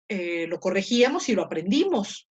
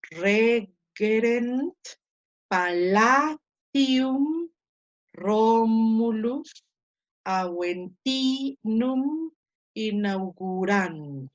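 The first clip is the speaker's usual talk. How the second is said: very slow Latin word list, each stressed syllable held long; stressed syllable drawn out; 3 dB louder, much slower than usual. Shown as a demonstration. Teacher finishes reading the marked Latin words, drawn-out stressed syllables. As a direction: stretch the stress, as in "Romulus" with a long RO. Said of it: "acentuándola, y entonces leo".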